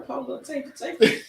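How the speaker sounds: ordinary talk, nothing marked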